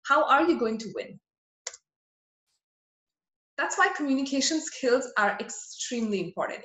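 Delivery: ordinary talk, nothing marked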